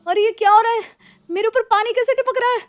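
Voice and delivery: loud, high startled outcry on waking; jolted awake, cry out in alarm. Acted. Woken by water dripping at night. (चौंककर ज़ोर से चिल्लाना)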